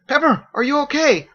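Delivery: high, loud concerned call; worried Fergus. A narrator's reading. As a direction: check on her anxiously.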